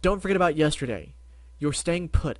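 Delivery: ordinary delivery, nothing marked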